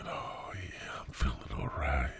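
soft unvoiced zoned-out whisper; mock drugged whisper. Joking that his meds kicked in, whispered and drowsy. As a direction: breathe it like the pills just hit. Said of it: "the antipsychotics is kicking in".

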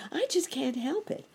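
high-pitched jolly cricket voice; cricket character. A narrator's reading. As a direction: play a little cricket, pitched well up. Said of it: "said the cricket".

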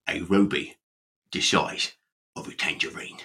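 low, hushed Cockney Michael Caine; breathy Caine half-whisper, dropping the voice; Michael Caine impression. Shown as a demonstration. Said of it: "your best impression of Michael Caine saying".

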